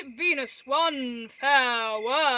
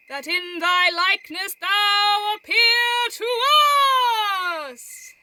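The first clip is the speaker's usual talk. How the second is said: mock conjuring call; 6 semitones higher, 8 dB louder than usual.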